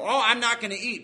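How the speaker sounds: loud, high, hammed-up announcement; mock showy refusal. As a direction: make a show of not eating. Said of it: "you know".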